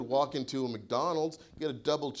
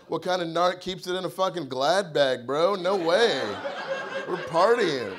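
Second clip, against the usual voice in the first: cocky party shout; 4 semitones higher, 6 dB louder than usual. Retold or imitated. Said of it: "I was like".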